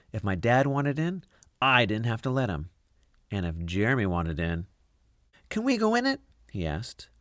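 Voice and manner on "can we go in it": put-on much higher boy's voice; eager boy, pitched way up; kid voice. Jeremy asks.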